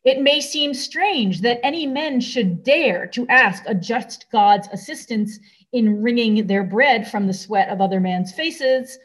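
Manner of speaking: normal talking voice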